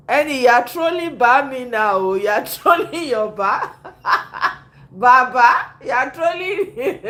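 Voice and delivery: words broken by real laughter; laughing. Real, not acted. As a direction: laugh through the teasing. Reactor laughing at the clip.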